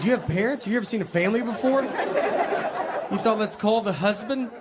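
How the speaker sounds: incredulous rant. Retold or imitated. Dad ranting at the school caller, high incredulous disbelief. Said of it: "I was like".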